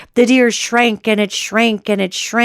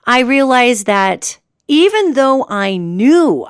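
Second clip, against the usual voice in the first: normal talking voice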